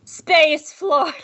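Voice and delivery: playful fanfare shout; hyped segment announcement. Pretend. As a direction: belt it like a silly title card.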